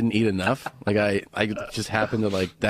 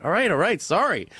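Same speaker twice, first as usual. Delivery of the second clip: higher, quick all right all right; placating apology; 7 semitones higher than usual. Retold or imitated. Friend apologising to grumpy travel partner, sheepish. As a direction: back off and appease him. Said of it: "I'm like".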